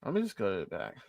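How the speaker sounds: normal talking voice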